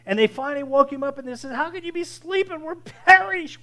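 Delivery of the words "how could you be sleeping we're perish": high, loud terrified plea; panicked pleading. Retold or imitated. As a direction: cry out as if about to drown. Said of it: "the disciples are all panicking".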